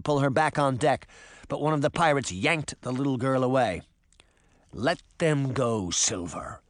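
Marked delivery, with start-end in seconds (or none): 4.7-6.6 slow, measured command dropping low; deliberate, stern order; firm demand. A narrator's reading. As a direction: demand it slowly and weightily. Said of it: "Cobweb demanded".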